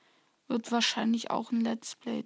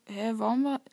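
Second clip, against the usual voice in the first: ordinary delivery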